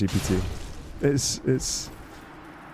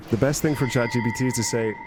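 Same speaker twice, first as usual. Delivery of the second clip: ordinary talk, nothing marked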